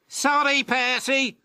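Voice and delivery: high, snooty dismissive engine voice; sniffing James. Acted. James turning down Percy, high snooty sniff. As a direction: brush him off with a sniff. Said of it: "sniffed James".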